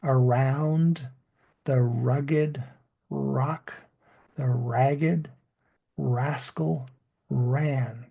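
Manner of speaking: "Around / the rugged / rock / the ragged / rascal / ran" in small groups, pauses between, clear R; broken-up teaching pace, every R in "rugged", "ragged", "rascal" fully sounded; English R demo. Shown as a demonstration. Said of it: "to learn how to say the English R sound".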